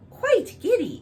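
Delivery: high, loud courtier echo. Acted. Courtiers echoing the king, high and loud. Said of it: "echoed all the courtiers".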